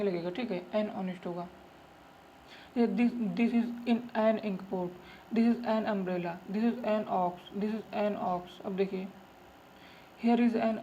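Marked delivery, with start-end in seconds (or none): 2.8-7.5 very slow, each word spaced; slow classroom reading; slow dictation. Shown as a demonstration. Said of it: "reading examples slowly". 7.6-8.6 slow repeat. Shown as a demonstration. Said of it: "repeat of example".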